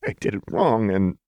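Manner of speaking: shaky mutter. A narrator's reading. Jinx blaming herself before Lux cuts in, shaky. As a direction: start shakily, as if about to go on. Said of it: "she muttered shakily".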